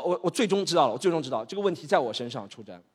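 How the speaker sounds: ordinary delivery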